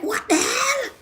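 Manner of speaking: shocked. Retold or imitated. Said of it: "I'm like".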